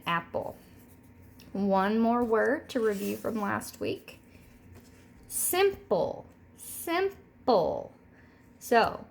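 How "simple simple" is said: simple said as sim-ple; syllable demo. Shown as a demonstration. Teacher modelling simple for the class. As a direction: split simple into two beats. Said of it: "we're going to say that word".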